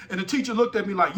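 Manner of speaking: ordinary talk, nothing marked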